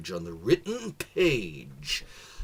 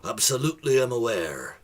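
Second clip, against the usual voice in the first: pirate voice; 8 dB louder than usual. Acted. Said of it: "The pirate said".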